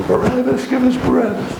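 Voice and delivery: mock crowd yell, pitched high; crowd screaming. Priests yelling at Pilate for Barabbas, high mock yell. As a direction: chant it like a mob calling for Barabbas. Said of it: "And they all scream".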